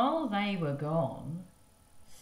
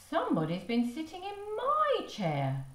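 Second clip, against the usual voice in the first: mother's voice; 11 semitones higher than usual. Acted.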